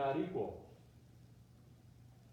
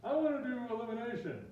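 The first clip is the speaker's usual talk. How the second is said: high, whiny mock complaint; griping student; 8 semitones higher, 4 dB louder than usual. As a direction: gripe like a stubborn student, pitched way up. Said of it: "imagine somebody griping for a while".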